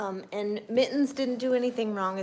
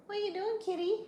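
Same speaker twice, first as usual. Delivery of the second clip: high, sing-song voice for the kitty; pet talk; 12 semitones higher than usual. Real, not acted. Owner cooing at her cat, high pet voice.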